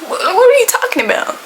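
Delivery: high-pitched incredulous question; mock bafflement. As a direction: ask the cat, high and baffled. Baffled reply to the meow.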